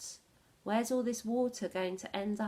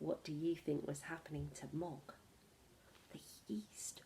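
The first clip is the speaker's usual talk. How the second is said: hushed whisper; 6 semitones lower, 10 dB quieter than usual. A narrator's reading. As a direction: breathe it like a secret to the class. Teacher reading story, hushed.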